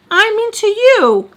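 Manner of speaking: loud, high, drawn-out mock indignation; incredulous outburst. Her answering the ex's accusation, loud incredulous outburst. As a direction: throw it back high and loud, slowly. Mock comeback in retold quarrel.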